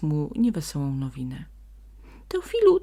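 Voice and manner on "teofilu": high, loud call of a name; calling from afar. A narrator's reading. Sprite runs up calling the donkey, loud high call. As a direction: shout his name across the forest. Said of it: "wołał już z daleka".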